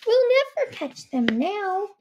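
high badger voice. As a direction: play a timid badger, high and careful.

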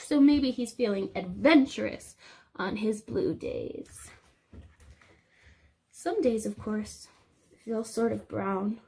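lively storybook read-aloud; reading to children. Acted. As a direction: read it to the little ones. Reading picture book to class.

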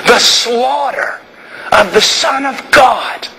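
drawn-out pulpit cries about the blood; impassioned preaching. Real, not acted. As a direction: preach the cross at full force. Preaching climax.

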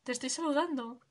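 normal talking voice

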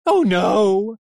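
high, loud frightened whimper. A narrator's reading. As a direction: whimper it in panic. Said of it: "it whimpered".

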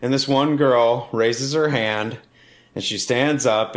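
ordinary talk, nothing marked

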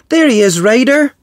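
high, urgent pup calling out; excited pup. Acted. Pup spots the lost dinosaur.

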